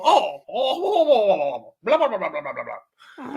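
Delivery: high, fluttery mock old-tourist babble. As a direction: parody dithering old folk going oh oh oh blah blah. Mimicking dithering old tourists.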